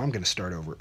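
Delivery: ordinary delivery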